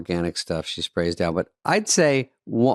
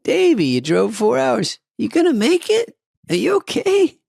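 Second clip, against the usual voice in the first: much higher, slow mock-caring dad voice. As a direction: mimic a sarcastic doting father. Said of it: "My dad would have said to me".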